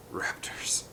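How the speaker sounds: breathy hushed dread; fearful whisper. A narrator's reading. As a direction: whisper the name in fear.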